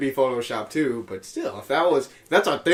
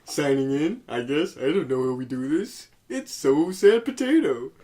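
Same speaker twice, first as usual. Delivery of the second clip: hammed-up Canadian, Muppet-like voice; Canadian accent; 3 semitones higher than usual. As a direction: put on a silly Canadian podcast-host voice. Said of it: "He sounds like a fucking Muppet".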